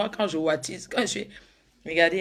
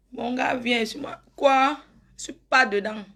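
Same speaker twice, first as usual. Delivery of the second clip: louder and higher, worked up about the sisters-in-law; talking loud; 5 semitones higher, 6 dB louder than usual. Acted. Fiancée getting loud about her sisters-in-law, heated. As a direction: let it burst out loud and indignant. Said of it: "excuse-moi de parler fort là".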